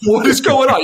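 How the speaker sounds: high-pitched, bewildered "what is going on"; mock baffled. Teammate retelling the stunned locker room, high and baffled. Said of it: "everyone was like".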